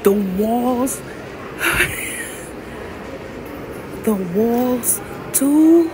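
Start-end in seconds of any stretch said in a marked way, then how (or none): 1.1-4.0 breathy laugh. Real, not acted. Amazed by the walls.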